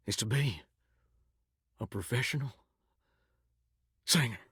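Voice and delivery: low, soft, halting reveal; hushed confession. Acted. Shower head confides a secret dream, hushed and halting. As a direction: let the secret out quietly, with long pauses.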